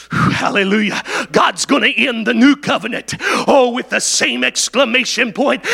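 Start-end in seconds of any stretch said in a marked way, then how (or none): none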